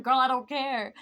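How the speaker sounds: loud, dismissive mock comeback; retold exasperated retort. Retold retort to nagging sister.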